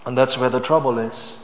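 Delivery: normal talking voice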